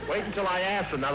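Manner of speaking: normal talking voice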